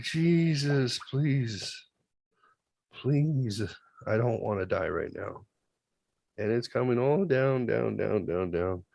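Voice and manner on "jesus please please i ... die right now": soft, frightened begging to Jesus; quiet terrified plea; hushed desperate prayer. Retold or imitated. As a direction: whisper a prayer as it comes down. Praying as the thing descends.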